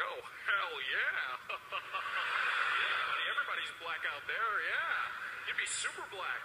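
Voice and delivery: slow, loud drawling American voice, hell yeah; hearty American buddy; American accent. Retold or imitated. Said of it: "He was like".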